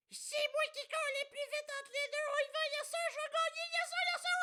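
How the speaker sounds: squeaky boastful racer; very high cocky racer voice; silly high voice. Pretend. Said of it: "voix de cul".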